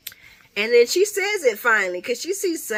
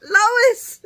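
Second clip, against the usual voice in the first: shocked delight; 13 semitones higher, 7 dB louder than usual. Retold or imitated. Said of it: "And I was like".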